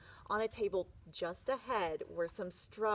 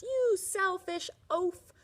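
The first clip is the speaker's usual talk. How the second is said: slow, loud, high yell; 10 semitones higher, 9 dB louder, slower than usual. Acted. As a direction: bellow the insult at the farmer. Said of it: "the man yelled at the driver".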